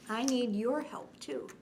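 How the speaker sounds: usual speaking voice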